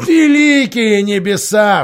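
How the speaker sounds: loud, high, slow exclamation; outraged cry. A narrator's reading. Miraz jumping up at his lords' advice, outraged shout. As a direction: burst out in outrage. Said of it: "вскричал Мирас".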